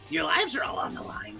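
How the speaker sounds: unvoiced, sinister whisper; menacing whisper. Villain taunting the students, whispered.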